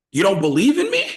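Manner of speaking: high, loud mock wounded protest; hurt Finn. Finn hurt his leader picked him to lose, loud high mock hurt. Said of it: "Finn is like".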